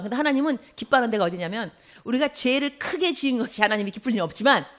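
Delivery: usual speaking voice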